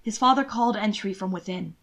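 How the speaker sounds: usual speaking voice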